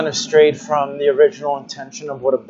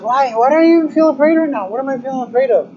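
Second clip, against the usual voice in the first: high, fast anxious self-questioning; retold fear; 9 semitones higher, much faster than usual. Speaker retelling questioning herself in the woods, high mock-fearful. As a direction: re-enact a rush of fear, pitched way up. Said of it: "little bit of fear came in".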